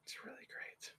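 soft whispered echo; co-host echoing the verdict, whispered. Real, not acted. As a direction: breathe it like a secret aside.

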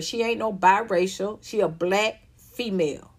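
ordinary talk

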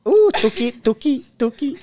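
high, silly teasing coo; playful cutesy cooing. Pretend. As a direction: put on a squeaky-cute playful voice. Playful teasing after being called cute.

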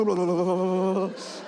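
long, wobbling babble of fright; mock shuddering noise. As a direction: babble as if trembling on a ledge. Acts out trembling over the edge.

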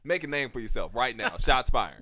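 loud, high hype-man teasing; excited hype. Real, not acted. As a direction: egg him on loudly. Hyping cousin to take shots.

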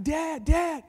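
high-pitched calling; retold high cry; yelling for dad. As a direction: call out as the stranded daughter. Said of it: "she was yelling".

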